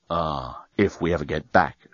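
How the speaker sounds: low, soft agreement; resigned agreement. A narrator's reading. Dan agreeing they may never get back, resigned. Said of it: "agreed Dan".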